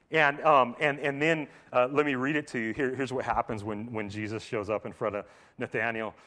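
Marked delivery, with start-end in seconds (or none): none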